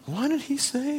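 high, hushed disbelieving question; mock the group's baffled reaction; incredulous. Home group asking in disbelief, high and hushed. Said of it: "the little home group was like".

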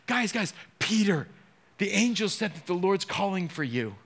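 breathless, high call to the disciples; excited women. Retold or imitated. Women running back to tell the disciples, high and excited. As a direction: burst in with the news.

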